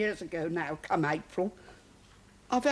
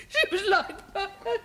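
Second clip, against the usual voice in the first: high, tearful, half-laughing sob; breaking into tears; 8 semitones higher than usual. Acted. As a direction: let the grief crack through on the last words. Cook breaks down over dead girl.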